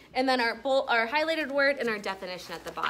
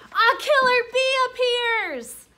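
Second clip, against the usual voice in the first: high, loud, slow alarmed reading; dramatic alarm; 13 semitones higher, 8 dB louder, slower than usual. A narrator's reading. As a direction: sound the alarm as the bee appears. Dramatic moment in read-aloud.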